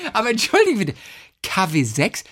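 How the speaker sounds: high, disbelieving sorry; incredulous. Acted. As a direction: pitch up in disbelief. Again correcting, in disbelief.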